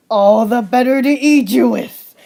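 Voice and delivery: loud menacing wolf voice; the wolf threatens Red in a loud menacing voice; wolf revealed. Acted. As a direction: drop the disguise and growl it out. Wolf reveals himself, louder.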